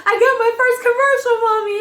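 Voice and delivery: high, loud, tumbling excitement; stammer, then burst out with the news; giddy excitement. Retold or imitated. Daughter calling mother with big news, re-enacted giddy excitement. Tells mother she booked it.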